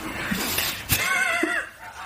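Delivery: very high laughing shout; shrieking laugh. Real, not acted. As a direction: crack up at your own joke. Joke about boyfriend's name.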